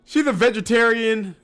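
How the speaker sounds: loud, high disbelief; incredulous. Real, not acted. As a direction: exclaim it, outraged and amazed. Outraged at a vegetarian character.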